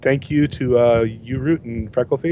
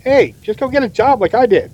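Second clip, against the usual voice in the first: role voice; 5 semitones higher than usual. Acted. Role-play scene reply.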